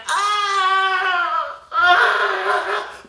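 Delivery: high, choked ah ah of pain; injured boy crying out after the blow; strangled pained cries. Retold or imitated. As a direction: wail through the hurt. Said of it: "He had, like, a silent cry".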